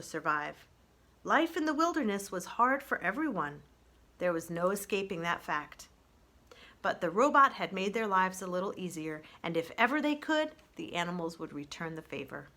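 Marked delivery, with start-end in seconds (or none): none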